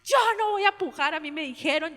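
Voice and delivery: high tearful refusal; crying refusal. Retold or imitated. The daughter refuses to push, crying and high. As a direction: refuse through tears. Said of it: "ay, lloraba".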